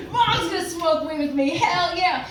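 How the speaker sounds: high excited glee without extra volume; mock-thrilled. Comic relives glee that mom will smoke too, high excited voice. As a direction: celebrate it in a high, giddy pitch.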